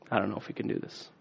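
hushed worried whisper; doubting whisper. Retold or imitated.

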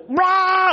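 loud, high mock scream; sudden shrieked outburst of shock; freaked-out yell. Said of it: "yell at each other".

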